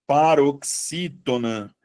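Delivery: PAROXÍTONA stretched out slowly; slow dictation. Shown as a demonstration. Teacher repeating the term for the class, slow dictation.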